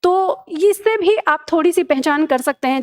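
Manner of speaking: normal talking voice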